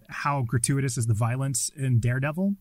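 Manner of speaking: ordinary talk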